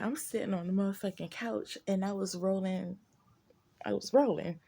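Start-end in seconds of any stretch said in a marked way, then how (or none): none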